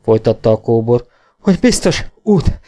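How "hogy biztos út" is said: high, halting, winded speech; breathless rider's report; panting. A narrator's reading. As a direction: catch breath between every few words. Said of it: "lihegte a karvajórú kóbor Jenny".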